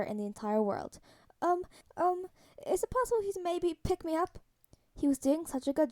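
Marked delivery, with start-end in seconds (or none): 1.4-4.4 put-on higher-pitched, hesitant boy's voice; nervous kid asking a favor; hesitant anxious boy. A narrator's reading. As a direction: ask haltingly, pitched up. Panicking boy timidly asks for help.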